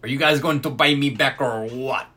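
lightly voiced drunk French Canadian; drunk French Canadian accent. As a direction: imitate the drunk teammate asking to be bought back. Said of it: "passive-aggressive Canadian wino".

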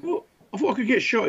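high, disbelieving protest; a touch of mock outrage; incredulous. Retelling his disbelief to sentry.

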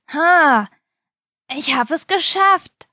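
put-on a young knight's loud, high victory cry; triumphant cheer. A narrator's reading. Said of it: "freute sich Philipp".

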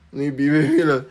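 laughing through a teasing maybe. Retold or imitated. Mocking the university that never answered, laughing. Teasing repeat of undecided staff.

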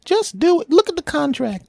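squeaky high voice. Acted. High voice insists.

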